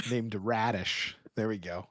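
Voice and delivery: ordinary talk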